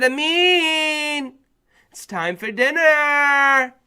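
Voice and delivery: mother calls her son down to dinner; mom calling. Retold or imitated. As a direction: call up the stairs like mom.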